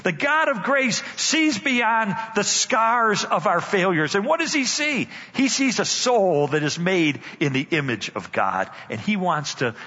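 usual speaking voice

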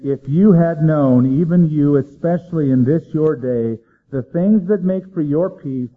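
preacher reading a lament with weight; solemn scripture reading. Acted. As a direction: grieve over the city as you read.

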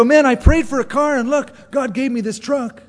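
loud, high, gloating brag; bragging neighbor. Pretend. Preacher voicing a friend boasting of his new truck, loud and high. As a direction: crow about the new truck. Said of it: "and you go".

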